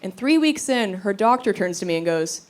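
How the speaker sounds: ordinary talk, nothing marked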